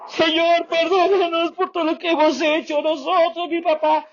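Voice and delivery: high, wailing plea for forgiveness; crying prayer. Retold or imitated. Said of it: "Y el chino arrancó a chillar como". (oración llorando, voz mucho más aguda)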